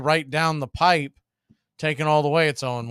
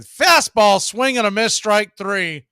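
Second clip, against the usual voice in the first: excited strikeout call; 4 semitones higher, 6 dB louder than usual. Real, not acted. Announcer calling strike three to radio listeners, excited and loud.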